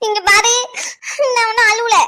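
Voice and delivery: tearful, sobbing protest; crying denial. Acted. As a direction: deny the tears while crying. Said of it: "அப்புறம் எதுக்கு நீ அழுதுகிட்டு இருக்க?".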